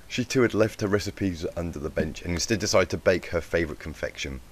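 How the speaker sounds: ordinary delivery, nothing marked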